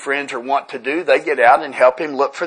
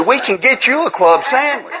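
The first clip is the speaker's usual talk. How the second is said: a higher, laughing retold offer; 10 semitones higher than usual. Offering the diner neighbour a sandwich, laughing. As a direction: chuckle it out, eager to help.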